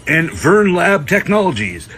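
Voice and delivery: booming mock commercial announcer. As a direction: sell it like a TV ad. Mock ad read for sponsor.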